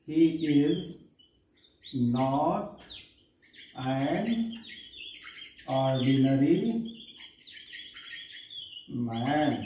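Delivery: each word of "He is not an ordinary man" set apart with long pauses; slow dictation. Shown as a demonstration. Teacher dictating an example sentence slowly to students. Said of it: "जैसे हमने कहा".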